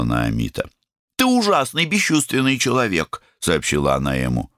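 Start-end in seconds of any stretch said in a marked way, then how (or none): 1.2-3.2 высокий обиженный голос; упрекни высоко, по-детски; обиженная девочка. A narrator's reading. Обиженная сестра. (обиженный девичий голос)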